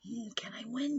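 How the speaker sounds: very soft anxious question; nervous self-talk. Acted. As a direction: ask yourself quietly under your breath. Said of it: "she's doing self-talk".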